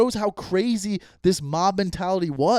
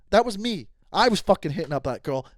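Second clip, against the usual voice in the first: ordinary talk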